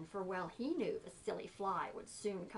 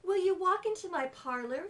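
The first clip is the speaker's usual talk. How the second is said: lightly voiced coaxing spider, higher; 7 semitones higher, 6 dB louder than usual. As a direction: lure the fly in sweetly. Spider voice in poem.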